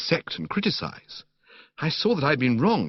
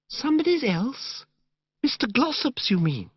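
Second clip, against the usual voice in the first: put-on gushing, dreamy young woman; 13 semitones higher than usual. Narrator voicing Madeline to Bertie in the park, high dreamy gush. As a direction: coo it like a sentimental girl.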